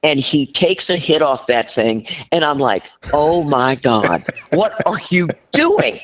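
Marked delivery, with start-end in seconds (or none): 3.1-6.0 appalled. Retold or imitated. Appalled at husband's airport spray.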